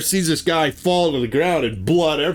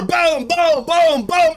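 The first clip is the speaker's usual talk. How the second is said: high, loud booming hits; imitated slamming booms; 11 semitones higher, 4 dB louder, slower than usual. Teller imitating the bear slamming Danielle against the door, loud booms. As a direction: pound out each boom.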